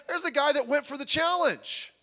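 ordinary delivery

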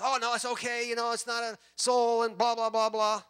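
high, loud mocking voice of dismissive excuse-makers; mocking impression. Mocking abortion providers' excuses.

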